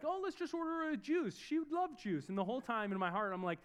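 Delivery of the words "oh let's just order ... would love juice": wife's suggestion voiced much higher; a light, high impression of his wife; imitating wife. Husband quoting his wife on the plane, lightly voiced high. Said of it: "my wife was like".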